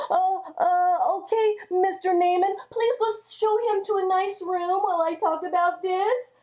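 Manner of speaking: stammer, buying time nervously; a worried king, lightly flustered. Acted. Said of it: "That made the king of Israel very afraid".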